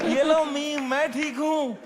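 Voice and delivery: mock tough dad. Imitating dad pretending fine.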